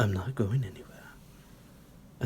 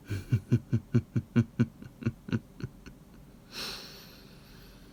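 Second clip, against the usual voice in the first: sinister chuckle; 2 semitones higher than usual. Acted. Vampire gloating over his captive pet, sinister chuckle. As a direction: chuckle darkly at your prey.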